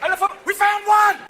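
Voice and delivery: very high, loud mock alarm; hammed-up security guard holler; alarmed shout. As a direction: yell out the discovery. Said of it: "Like, going".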